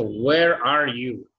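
"Where are you" said very slowly, stress on where; slow model question. Shown as a demonstration. Teacher slows down so the student can repeat. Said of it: "teacher models question".

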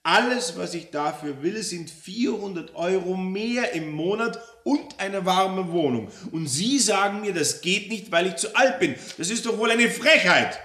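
high, loud, outraged complainant; aggrieved customer demanding her due; indignant Frau Roller. Acted. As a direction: build from pointed address to loud outrage.

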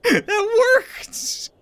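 can't believe it worked; real squeal of delight; thrilled disbelief. Real, not acted. Surprised the trick succeeded.

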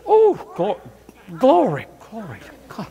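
high, rapturous cry of glory; ecstatic oh glory. Retold or imitated. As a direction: imitate the overjoyed groom crying glory. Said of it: "the "Oh, glory man".

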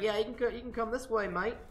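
casual Australian "mate" greeting, imitated; Australian accent. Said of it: "it just came out on Australian accent".

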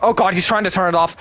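normal talking voice

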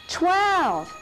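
high, clear count on the beat; count out loud. Shown as a demonstration. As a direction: call the number out loud. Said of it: "we're gonna say that one loud".

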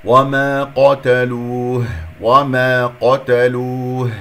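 وما قتلوه chanted slowly, thick q and rounded loo; heavy qaf. Shown as a demonstration. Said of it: "القاف مفخمة مرتبة ثانية".

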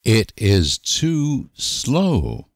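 ordinary talk, nothing marked

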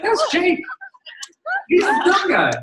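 high-pitched mock exclamation; excited shopper. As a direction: pipe up like a shopper recognizing a face. Imagined shopper spotting Jake in store.

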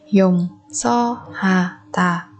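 slow, spaced Dùng so ha tà; syllable by syllable. Shown as a demonstration. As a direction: pause after each syllable of Dùng so ha tà. Teacher splits word by syllable. (đọc chậm Dùng so ha tà từng âm tiết)

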